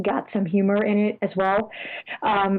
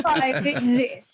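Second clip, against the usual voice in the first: movie trailer voice; 3 semitones higher, slower than usual. Pretend. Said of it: "In a world without comics".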